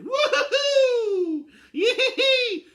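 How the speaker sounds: high, loud, slow woo-hoo and yee-hee laughing; giddy cackling whoop. Real, not acted. Fan cackling gleefully that his plan B started. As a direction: cackle with pure glee.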